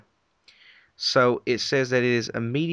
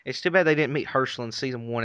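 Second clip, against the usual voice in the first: ordinary delivery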